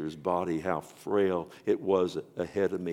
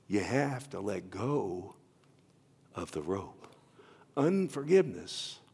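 tender appeal; 4 dB quieter than usual. Real, not acted. Preacher urging loved ones to let go, tender. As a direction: plead gently with the congregation.

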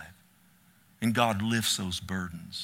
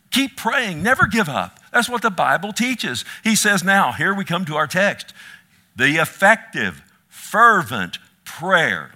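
preaching at full force; 4 semitones higher, 7 dB louder than usual. Acted. Pastor exhorting his congregation never to give up, loud and high. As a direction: call the whole church to keep praying.

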